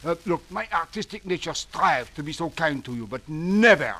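put-on exasperated French accent; flustered French theater director.

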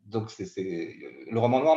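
usual speaking voice

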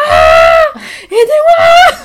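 very high, loud shriek with a laugh behind it; screaming for help. Retold or imitated. On her knees in labour, high screamed plea. Hanging on drip stand, labour.

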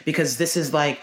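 ordinary talk, nothing marked

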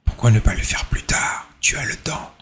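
hushed, coaxing whisper to put it off; tempting whisper. Pretend. Little voice on your shoulder tempting you to wait, whispered. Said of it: "une petite voix qui te chuchote".

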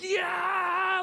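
loud, high-pitched scream of rage; so mad it comes out as a wordless roar; enraged yell. Shown as a demonstration. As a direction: demonstrate a furious, drawn-out yell. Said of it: "you ever get so mad".

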